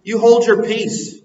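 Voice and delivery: rebuking crowd. Retold or imitated. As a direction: hush them sharply. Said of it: "rebuking them".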